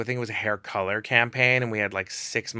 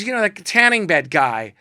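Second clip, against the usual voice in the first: upset model; 8 semitones higher, 7 dB louder than usual. Retold or imitated. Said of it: "she goes".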